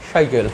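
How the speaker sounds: সাইকেল with a low "sh" at the start; tongue off teeth. Shown as a demonstration. Teacher showing the palatal sound to students, সাইকেল said as "shaikel". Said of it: "জিভটা দাঁতে না লাগিয়ে উচ্চারণ করো".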